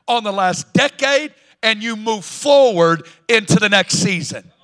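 loud, rising preacher's proclamation; full-force preaching. Acted. Pastor exhorting the congregation to move forward, loud preaching. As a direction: preach it out, building to a roar.

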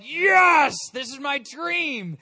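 high, loud burst of delight on the first word; thrilled. Retold or imitated. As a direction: gush like a dream just came true. Imagined thrill of being in London.

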